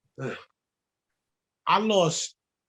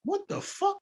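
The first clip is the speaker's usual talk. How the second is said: stunned reaction; 9 semitones higher, 5 dB quieter, slower than usual. Retold or imitated.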